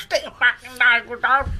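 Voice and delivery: lightly voiced cockney toff; mock cockney demand. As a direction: snap it like a lazy bloke on the sofa. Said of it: "He goes".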